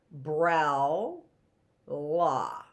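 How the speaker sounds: "brel" and "la" said as separate beats; chunked syllables. Shown as a demonstration. Teacher chunking umbrella into syllables for kids, clipped beats. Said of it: "When do we stop at the word".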